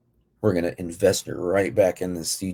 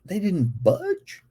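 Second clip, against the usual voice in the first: disbelief; 10 semitones higher, much slower than usual. Acted. Mock disbelief.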